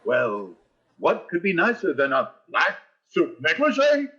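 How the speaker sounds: usual speaking voice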